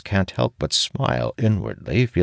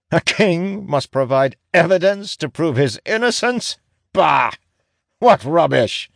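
bitter scoffing; 13 semitones higher, 3 dB louder than usual. Acted. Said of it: "He barks a bitter laugh".

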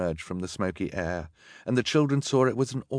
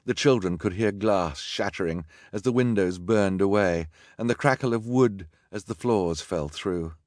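plain reading voice

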